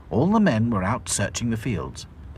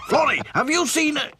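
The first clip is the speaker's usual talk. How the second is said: high, louder flustered boss; Grimes hurried; 4 dB louder than usual. A narrator's reading. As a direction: burst in asking. Grimes rushing in.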